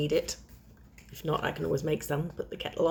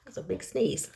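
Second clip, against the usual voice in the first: ordinary delivery, nothing marked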